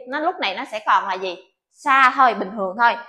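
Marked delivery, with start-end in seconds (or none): none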